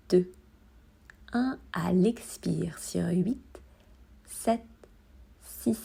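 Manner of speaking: slow, hushed count with a pause after each number; breathing count. Acted. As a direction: count the breath down softly, one number at a time. (décompte lent et doux de la respiration)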